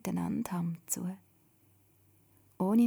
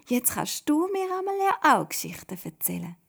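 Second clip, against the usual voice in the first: high, loud, delighted exclamation; happily calling out; 7 semitones higher, 5 dB louder than usual. A narrator's reading. Simon asking Toda for stories, happily excited. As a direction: burst out with pleased anticipation. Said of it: "ruft er ganz zufrieden".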